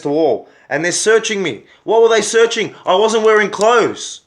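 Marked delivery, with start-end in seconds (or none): 1.8-4.2 raised, louder, real indignation; indignant outburst. Real, not acted. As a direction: protest hotly at the pointless search. Outraged at being searched.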